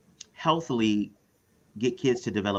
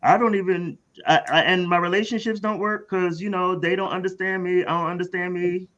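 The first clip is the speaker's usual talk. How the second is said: much higher, streetwise struggling parent; parent character voice; 7 semitones higher than usual. Retold or imitated. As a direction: restart the line fully in character. Role-playing parent asking counselor.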